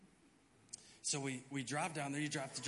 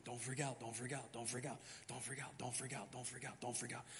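soft, fading self-calming mantra; hushed inner chant, getting softer; inner voice whispering; 4 semitones lower, 8 dB quieter than usual. Retold or imitated. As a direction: murmur it to yourself to stay calm. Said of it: "this thing inside of me is going".

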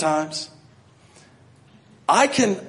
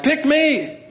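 mock eager volunteer; 12 semitones higher than usual. Moses not eager at the bush.

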